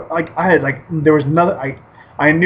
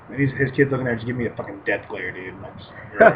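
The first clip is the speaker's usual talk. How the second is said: ordinary talk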